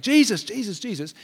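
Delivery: mild mock scream, high then dropping off; imitated screaming. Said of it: "He keeps screaming out".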